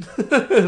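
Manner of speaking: short, loud, high laugh; high rueful laugh. Real, not acted. Laughing ruefully that shared descent is all that binds them. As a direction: let out a quick bitter laugh.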